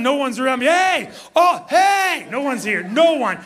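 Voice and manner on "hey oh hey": loud, high call to nobody; shouting across the water, much higher; excited yell. Retold or imitated. Pastor yells to nearby fishermen about his catch, excited shouting. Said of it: "I'm yelling".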